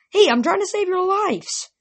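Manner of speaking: high-pitched, exasperated objection; indignant protest. Acted. Protesting being left out of quest.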